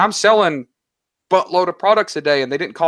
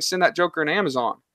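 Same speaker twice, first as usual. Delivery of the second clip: normal talking voice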